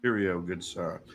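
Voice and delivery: low, soft mock British toff; posh British voice. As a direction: play a proper English gentleman. Mock British banter, deep and quiet.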